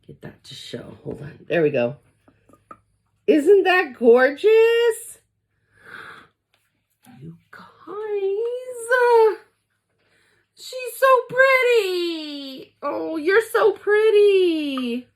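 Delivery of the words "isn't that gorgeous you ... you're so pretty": high, loud, slow, near crying with happiness; tearful joy. Real, not acted. Castle warmer lit up, she cries with joy. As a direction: let the voice break with overwhelmed joy. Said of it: "I kind of feel emotional right now".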